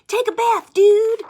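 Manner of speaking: high, loud bossy fly shout. Acted. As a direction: yell it up high at the smelly pigeon. Fly telling Pigeon to bathe.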